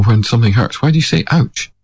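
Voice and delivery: ordinary talk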